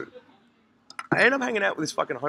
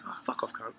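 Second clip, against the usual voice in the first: unvoiced, softer muttered brush-off; hushed dismissive jab; whispered retort; 8 semitones lower, 12 dB quieter than usual. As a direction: whisper it under your breath. Muttered retort to threatening men.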